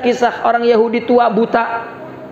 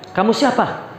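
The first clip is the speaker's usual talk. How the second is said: put-on suspicious old man. Blind old man questions Abu Bakar, lightly voiced and suspicious.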